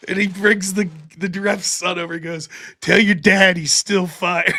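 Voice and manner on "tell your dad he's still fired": slow, loud mocking taunt; gloating shout. Villain boss taunting the fired ref's young son, slow loud shout.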